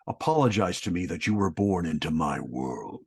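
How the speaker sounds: low, whispered menace; prideful Escanor. Acted. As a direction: look down on her as a god. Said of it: "you're in your prideful form".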